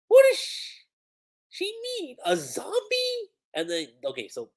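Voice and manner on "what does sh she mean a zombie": very high-pitched, incredulous questions; alarmed disbelief. Acted. Revived character learning about being a zombie, alarmed disbelief. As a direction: squeak it out in disbelief.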